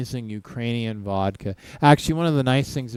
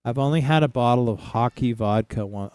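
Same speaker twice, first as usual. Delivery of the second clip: ordinary talk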